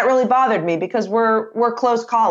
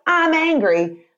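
higher, pointed "I'm angry" as an absolute; mock angry statement; 7 semitones higher, slower than usual. Coach showing a colleague the blunt absolute, mock angry. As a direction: snap out "I'm angry" like a wall going up. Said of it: "But if I'm like".

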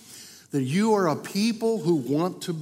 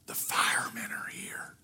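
put-on child's whisper; 12 dB quieter than usual. Little boy whispering to the salesman on the phone. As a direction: breathe it like a kid hiding something. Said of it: "And the little voice said".

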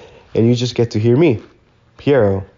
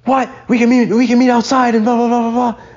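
very high-pitched imitation of someone going ape; mock furious ranting; 12 semitones higher than usual. Cook threatens to meet him outside, mock furious. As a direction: mimic his outburst way up high, dissolving into blahs. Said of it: "he just went ape shit".

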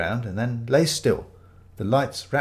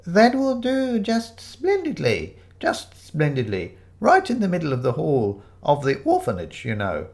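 put-on quavery elderly vicar; 5 semitones higher than usual. Fake vicar claims the tree for the orphanage, slow and quavery. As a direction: dither happily like an old vicar.

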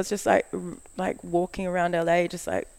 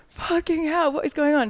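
much higher, exasperated outburst; shocked disbelief; 11 semitones higher, 3 dB louder than usual. Retold or imitated. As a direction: blurt it out in stunned disbelief. Shocked at being told to sleep on the sofa.

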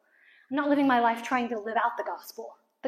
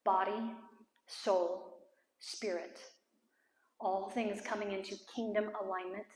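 prayer; 2 semitones lower, 7 dB quieter than usual. Real, not acted. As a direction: declare it quietly over bowed heads. Leads a closing prayer.

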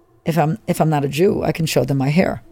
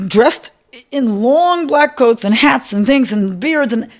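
distraught; 8 semitones higher, slower than usual. Real, not acted. Recalling humiliation, crying.

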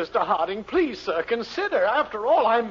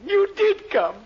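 overjoyed cry; 6 semitones higher than usual. Acted. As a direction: gush with delight he came. Shopkeeper overjoyed at visit.

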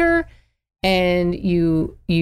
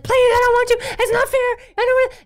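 put-on high, whiny child pleading; squeaky kid begging; whining child. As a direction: beg like a kid denied a bath. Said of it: "if it's like".

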